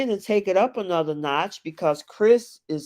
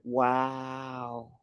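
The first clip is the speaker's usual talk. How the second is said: flat stunned wow; 4 semitones lower, 8 dB quieter than usual. Retold or imitated. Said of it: "I'm like".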